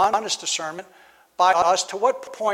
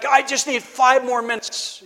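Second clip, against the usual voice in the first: higher, slow drawn-out plea; child bargaining; 5 semitones higher, 4 dB louder than usual. Retold or imitated. Child stalling at bedtime, in a slow whiny plea. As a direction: stretch it like a kid stalling bedtime. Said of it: "or you say".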